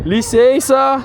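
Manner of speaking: calling from far away. Real, not acted. Calling out entering house.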